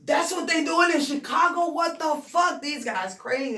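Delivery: high, loud, incredulous outsider voice; mock disbelief. Rapper imitating outsiders shocked by Chicago's sound, high and loud. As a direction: play the stunned outsider hearing Chicago rap.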